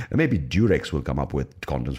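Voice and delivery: ordinary talk, nothing marked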